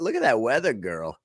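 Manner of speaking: usual speaking voice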